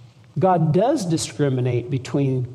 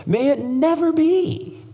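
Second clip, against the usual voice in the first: much higher, slow emphatic cry; emphatic denial; 12 semitones higher than usual. Shown as a demonstration. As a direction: proclaim it as an outraged never. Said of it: "emphatically no".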